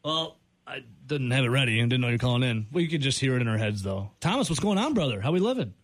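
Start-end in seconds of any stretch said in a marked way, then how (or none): none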